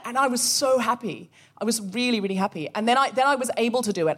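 ordinary talk, nothing marked

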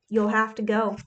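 plain reading voice

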